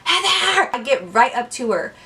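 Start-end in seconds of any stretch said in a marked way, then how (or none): 0.0-0.7 high, drawn-out attempt at a hushed call; strained hushed call. Calling to passed-out friend on the stairs, high strained call. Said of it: "I'm trying to whisper".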